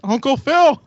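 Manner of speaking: high, loud announcing call; Uncle Phil call. Retold or imitated. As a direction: toss it out like a punchline. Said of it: "throws it at Steve and goes".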